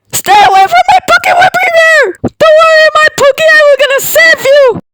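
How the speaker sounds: very high, loud and fast panicked yelling; frantic shout. Acted. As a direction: shriek to save the toy. Protecting toy, frantic shouting.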